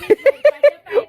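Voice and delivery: loud high laughing burst; burst of laughter. Real, not acted. Vlogger laughing at the pipe swing, loud laughter.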